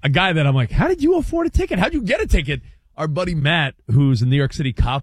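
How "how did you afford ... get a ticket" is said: high-pitched disbelieving question; incredulous. Retold or imitated. Grilling a friend about his ticket, incredulous. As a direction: act baffled by their luck, voice jumping up.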